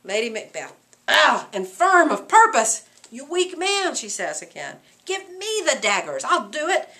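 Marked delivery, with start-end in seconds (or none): 1.1-3.9 loud, slow, higher scorn; contemptuous. Acted. Lady Macbeth scorning her husband, loud. 5.0-6.9 a bit higher, firm; lightly voiced, commanding Lady Macbeth. Lady Macbeth taking the daggers from her husband, firm.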